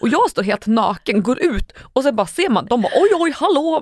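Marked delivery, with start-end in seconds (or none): 3.0-3.8 startled greeting. Retold or imitated. Acting surprised male neighbours' greeting.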